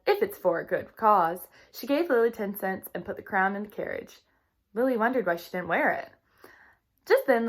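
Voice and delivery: lively read-aloud storytelling voice; reading to children. A narrator's reading. Teacher reading a storybook to her class, lively. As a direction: read the chapter brightly to young listeners.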